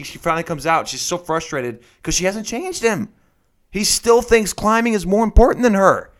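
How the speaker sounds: usual speaking voice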